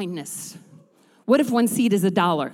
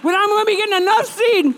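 high, loud, shouting preacher's build; preaching; 9 semitones higher, 6 dB louder than usual. Acted. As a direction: preach it out over the music. Preaching climax, congregation praise.

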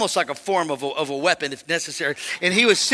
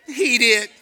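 lightly voiced little kid, higher; sulky toddler; 4 semitones higher, 4 dB louder than usual. Preacher plays grandson answering mom, little-kid voice. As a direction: imitate a small child's reluctant answer.

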